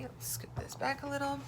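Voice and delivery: whispered, voiceless aside. Real, not acted. Teacher whispering while moving the paper. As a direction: whisper it to yourself.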